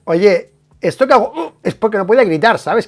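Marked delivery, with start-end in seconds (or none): none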